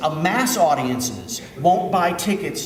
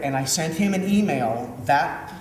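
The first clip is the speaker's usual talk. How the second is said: ordinary talk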